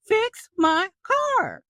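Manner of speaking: high, loud indignant demand; mock demand. Car owner to the driver who hit her, high indignant demand. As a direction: insist they pay up. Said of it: "No, you're like".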